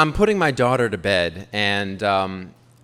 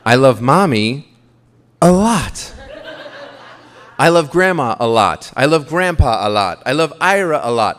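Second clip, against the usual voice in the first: put-on child voice, louder, 1950s-movie politeness; prim little girl; 6 dB louder than usual. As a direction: play a four-year-old acting super adult. Dad voicing daughter's grown-up tone.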